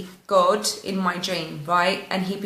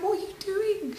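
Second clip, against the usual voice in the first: imitating mum, higher and softer, bewildered; a hint of a frightened mother asking; worried mum; 12 semitones higher, 7 dB quieter than usual. Mum confused by crying daughter.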